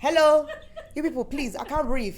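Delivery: high, loud and breathless with laughter; laughing overwhelmed. Retold or imitated. Host overwhelmed as everyone celebrates her viral cover, laughing and loud. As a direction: laugh through the words like it's too much.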